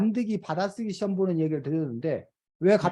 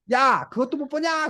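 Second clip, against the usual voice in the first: high-pitched mock exclamation; parroted outburst; 7 semitones higher than usual. Pupil reading out dad's words to his teacher, mock indignant. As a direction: lightly act a child copying an angry dad.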